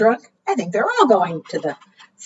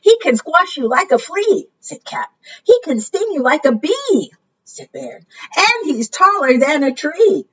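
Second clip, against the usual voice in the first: worried warnings; 8 semitones higher than usual. Acted. Animals warn Mama Frog.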